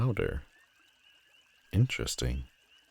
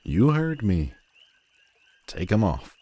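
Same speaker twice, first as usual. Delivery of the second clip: higher, louder command; 5 semitones higher, 4 dB louder than usual. Acted. Villain ordering the hero to remove his gloves, commanding. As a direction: snap the order, no argument.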